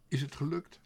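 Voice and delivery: eager high call. A narrator's reading. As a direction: call it out in mid-flight. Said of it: "riep Toep al vliegend".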